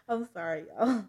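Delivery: real laughter breaking into the words; laughing apology. Real, not acted. Reader apologizing to listeners mid-story, laughing. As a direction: apologize through your laughter.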